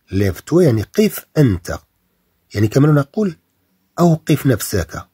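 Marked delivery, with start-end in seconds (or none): none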